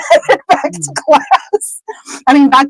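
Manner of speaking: high, slow words through giggles; laughing while talking. Real, not acted. Storyteller cracks up mid-sentence, words pushed through laughter. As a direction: let the giggle stretch the words out. Teller giggles at own slip.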